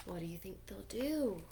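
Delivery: put-on suspenseful whisper to the kids. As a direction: hush it like a secret. Teller asks children in suspense.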